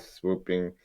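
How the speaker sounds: normal talking voice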